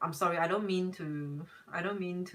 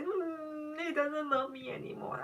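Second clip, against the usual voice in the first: higher, softer mock whimper of a lovesick girl; mock heartbroken girl; 8 semitones higher, 6 dB quieter than usual. Mocking girls who cry over boys, in a higher, softer whiny voice. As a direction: pout it out like a girl pining over a boy. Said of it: "we're like".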